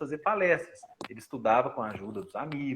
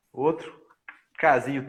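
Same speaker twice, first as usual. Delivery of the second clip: ordinary delivery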